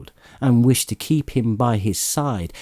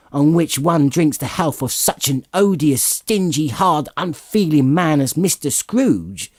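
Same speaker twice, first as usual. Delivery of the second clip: higher, sarcastic tirade; scornful wife; 5 semitones higher than usual. Acted. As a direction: spit out each insult.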